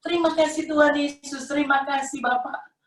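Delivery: high, sustained thankful call; retold cry of thanks. Woman thanking God as sight returns, retold cry. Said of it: "Terus saya jerit".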